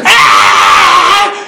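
loud drawn-out scream, acting out the story; mock scream. Preacher acting out John touching the hot lighter, mock scream. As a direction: let out a loud yelp of pain as retold. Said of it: "and screams".

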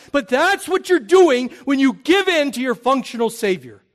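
loud, high preaching swell; a fiery pastor raising his voice to wake up his congregation; full-force preaching. Real, not acted. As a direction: drive the warning home from the pulpit.

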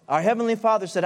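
ordinary talk

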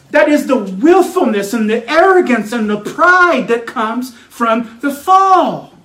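rising pulpit insistence; forceful preaching. Acted. Preacher insisting unbelief comes from the fall, forceful preaching. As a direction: drive the point home, stretching the last phrase.

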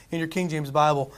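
ordinary talk

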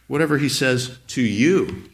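"to you" set apart and emphasized; stress on TO YOU. Shown as a demonstration. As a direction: make it personal, landing firmly on "to you". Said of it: "Emphasize different words in the text".

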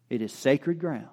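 ordinary talk, nothing marked

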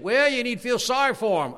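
loud, high-pitched imitated objection; mock protest. Objector telling preacher to feel sorry, loud mock protest. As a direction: shout it as a mocked objector.

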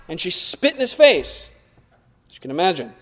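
ordinary talk

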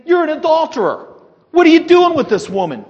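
sharp, high, loud accusation; accusing rebuke. Retold or imitated. Paul rebuking Felix for his adultery, high and loud. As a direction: call out his sin, rising high and loud.

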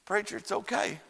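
small, quiet voice; soft reassurance. Retold or imitated. Beaten boy reassuring his preacher, soft voice. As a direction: say it gently, well under full voice.

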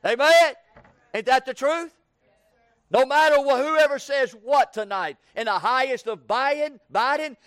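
preaching. Acted. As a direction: drive it home to the congregation.

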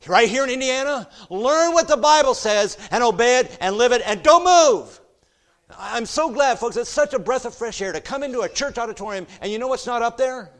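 high, loud fiery sermon; preaching at full force. Acted. Preacher exhorting the church, shouting. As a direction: thunder it over the congregation.

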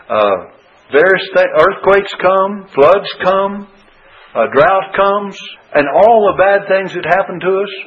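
impassioned sermon delivery; full-force preaching. Acted. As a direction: drive every line home to the flock.

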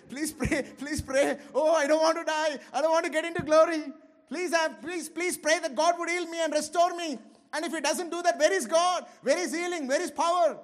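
high, loud, frantic begging; hammed-up terror of dying, pleading for prayer; mock panicked plea. A frightened believer begging the church to pray, mock panic.

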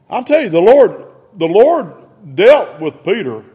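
ordinary talk

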